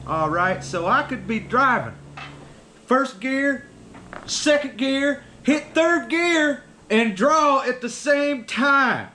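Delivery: high, slow Southern drawl imitation; a slow, high Southern boast; Southern guy impression. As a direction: put on the proud Louisiana buddy's drawl. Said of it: "He said".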